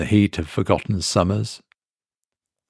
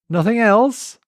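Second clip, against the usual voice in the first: much higher, coaxing sly question; tempting; 13 semitones higher than usual. A narrator's reading. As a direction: lure him into ordering more. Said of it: "tempting him".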